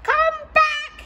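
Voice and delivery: high, loud call across the beach; shouting. Acted. Robot calling to rabbits at sea, high loud shout. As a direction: shout after them far away. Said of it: "he shouted".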